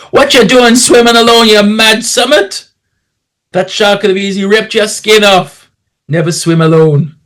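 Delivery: high, scolding Welsh voice; best Welsh accent. Acted. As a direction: shout it crossly in a put-on Welsh accent. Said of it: "in my best Welsh accent".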